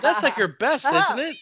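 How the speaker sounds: excited. Real, not acted. Cheering a friend's great roll.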